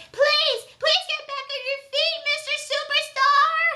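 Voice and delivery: put-on high, begging little boy cheering; pleading kid fan. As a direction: squeak it out like a desperate young fan. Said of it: "He's like".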